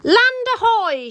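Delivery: high excited call of land ahoy; shouted. A narrator's reading. Rossi spotting land through her telescope, calling out high. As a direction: sing out the sighting from the lookout. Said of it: "shouted Rossi".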